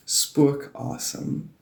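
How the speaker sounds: Spookasem said slowly in Afrikaans, SPOO-ka-sem; Afrikaans word. Shown as a demonstration. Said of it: "it's called spookasem".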